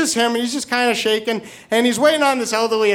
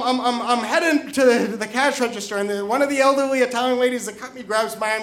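ordinary talk

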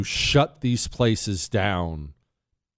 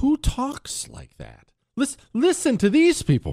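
appalled disbelief; 12 semitones higher than usual. Real, not acted. Host urging listeners to hear the next clip, appalled disbelief. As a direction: stretch it out in high-pitched disbelief.

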